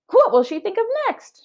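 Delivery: high, exasperated character voice; Amelia Bedelia voice. Acted. Teacher reading aloud, Amelia puzzled by the list, high voice. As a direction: pitch up in amused disbelief.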